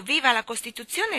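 rousing cheer; crowd cry. Acted. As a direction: call it out across the square. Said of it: "Il grido".

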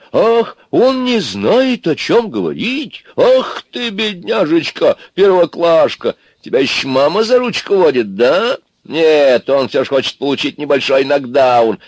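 high, slow, loud mock pity; indignant mockery. A narrator's reading. Everyone rounds on Аристарх, indignant mockery. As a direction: coo scornfully as if at a helpless first-grader. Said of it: "Но тут ж возмутились все".

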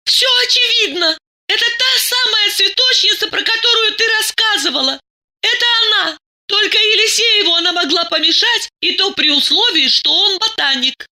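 very high-pitched, indignant argument; heated accusation. A narrator's reading. Masha insists to Diana it is obvious, heated. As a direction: press the case in a high, agitated voice.

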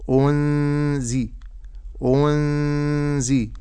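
أنزي very slow, nun hidden in nasal ghunna; correct ikhfa. Shown as a demonstration. Teacher modelling the correct hidden nun. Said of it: "ولكن أقول".

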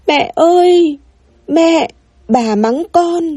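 high-pitched cry out to mum; child's wail. A narrator's reading. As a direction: wail your complaint like a scolded child. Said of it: "hai chân đạp loạn xạ gào to". (giọng trẻ con gào gọi mẹ, mách tội)

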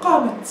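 قامت stopped on a still t; pausal stop. Shown as a demonstration. Teacher shows students how to stop on qamat, pausal t. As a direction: end قامت on a vowelless t, not h. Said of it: "قف على قامت".

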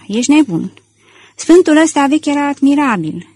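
mildly outraged, voice rising; losing his temper. A narrator's reading. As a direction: protest as if beside yourself. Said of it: "ieșindu-și din fire".

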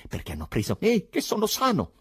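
high, loud irate outburst; indignant rant rising in pitch; full of anger. A narrator's reading. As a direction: protest furiously, voice climbing and speeding up. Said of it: "pieno di ira".

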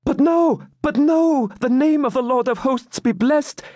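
very high exultant cry; joyful, eager shout. A narrator's reading. Maiden sees champion rise, shouting joyfully. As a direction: burst out high with relief. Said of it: "shouted with joyful eagerness".

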